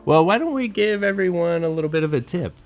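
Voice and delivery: ordinary talk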